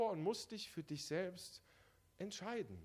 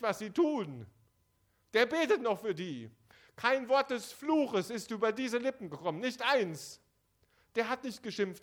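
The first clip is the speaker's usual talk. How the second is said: loud, raised sermon voice; fervent preaching; 5 semitones higher, 7 dB louder than usual. Acted. Preacher proclaiming the crucifixion to the congregation loudly. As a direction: preach it out to the hall with fire.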